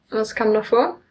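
ordinary talk, nothing marked

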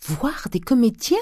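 high-pitched scandalized disbelief; scandalized, pitched sharply up; shocked exclamation. A narrator's reading. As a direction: exclaim as if at something sinful. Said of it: "s'écria madame Grandet".

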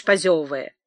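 usual speaking voice

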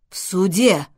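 loud, horrified question. A narrator's reading. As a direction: echo it in mild horror. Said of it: "ужаснулся Гермес". (ужаснувшись)

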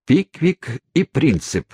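slow, high, resounding cry; ringing exclamation. A narrator's reading. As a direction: proclaim it like a rallying call. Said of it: "воскликнул мистер Уэллер звучным голосом".

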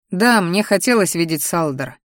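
ordinary delivery

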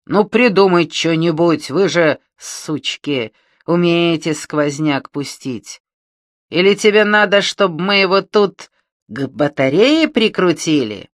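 громкий злой медленный окрик; рявкнуть с угрозой. Acted. Бандит угрожает Мане. (злобно орёт)

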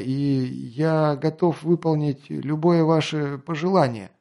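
slow, halting, nervous answer; slow flustered reply. Acted. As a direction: stumble through it nervously. Flustered officer answers slowly.

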